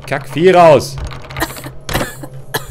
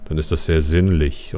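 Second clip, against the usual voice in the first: mock sultry voice; 7 semitones lower, 5 dB quieter than usual. Shown as a demonstration. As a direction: purr it, mocking close-mic voices. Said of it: "Man muss nur so machen".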